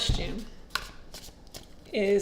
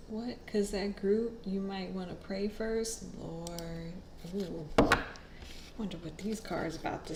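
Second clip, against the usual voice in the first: hushed; 7 dB quieter than usual. Real, not acted. Reacting to the cards.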